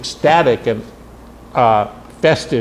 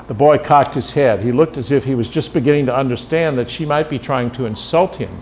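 plain reading voice

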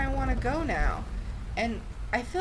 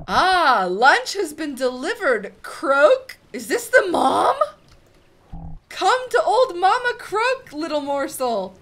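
loud, higher, croaking monster mother gloating over lunch; hungry toad mother; 6 semitones higher, 10 dB louder than usual. Acted. Toad mother greets her lunch, loud and gloating. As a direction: play a greedy swamp witch spotting food.

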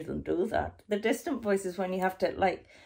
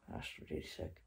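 whisper; 14 semitones lower, 16 dB quieter, much faster than usual. Real, not acted. Whispered reaction after neckworm.

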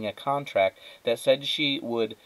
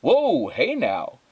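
louder, slow startled whoa; 7 semitones higher, 6 dB louder, much slower than usual. Acted. Husband startled as the vacuum takes off, loud and slow. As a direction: caught off guard as it lurches.

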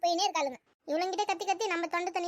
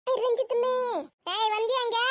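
high-pitched call across the house; impatient raised call; summoning; 5 semitones higher than usual. Acted. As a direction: shout for your daughter to come. Mother summons daughter from afar.

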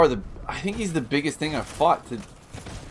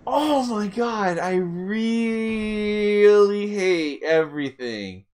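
high, long drawn-out wail; slow howl of frustration; venting; 6 semitones higher, much slower than usual. Real, not acted. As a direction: stretch each word in exasperation. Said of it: "to vent like that".

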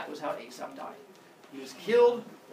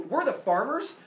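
complaining farmers; 10 semitones higher, 7 dB louder than usual. Acted.

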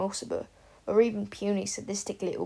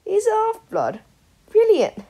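much higher and louder, delighted; gleeful shout; 13 dB louder than usual. Acted. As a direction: crow with malicious delight. Sirius gleeful at the news.

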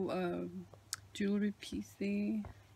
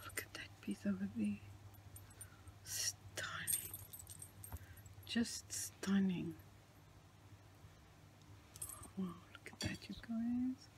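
hushed, breathy whisper of wonder; awed whisper; 11 dB quieter than usual. Real, not acted. Showing the journal pages to viewers, awed whisper. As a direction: whisper it like the page might break.

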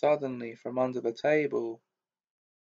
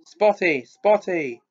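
yelling taunt; 5 semitones higher, 7 dB louder than usual. A narrator's reading.

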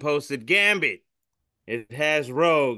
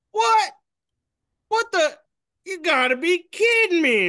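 very high, louder cartoon disbelief; high-pitched whale yelping in disbelief; stunned whale; 5 dB louder than usual. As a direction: squeal it out like a shocked whale.

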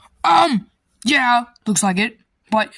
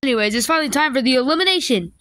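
normal talking voice

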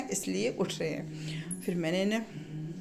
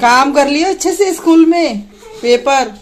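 calling out; 5 semitones higher, 17 dB louder than usual. Real, not acted. Calling after kids leaving. (School जाते बच्चों को ज़ोर से पुकारना)

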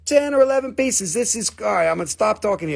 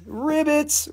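high-pitched little ribbit; playful high croak; 6 semitones higher than usual. Pretend. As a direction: squeak the name up high. Playing with a buyer's name.

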